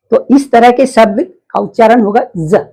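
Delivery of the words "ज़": "ज" begun with a buzz, zza; vibrating Z sound. Shown as a demonstration. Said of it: "कंपन होते हुए ज निकलना चाहिए". ("ज" कंपन वाला ज़)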